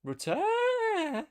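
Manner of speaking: very high, loud, held celebratory cry; excited cheer. Real, not acted. Gamer celebrating Rutter's goal, high excited cheer. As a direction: shriek the player's name, stretching the end out.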